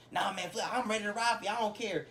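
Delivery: high-pitched, dismissive bravado; blindly loyal friend. Acted. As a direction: act out a buddy waving off all doubts.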